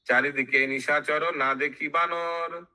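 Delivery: chanted line with drawn-out words; sing-song verse, lingering on the ending; verse recitation. Acted. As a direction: intone it like epic scripture. Verse chanted before its explanation.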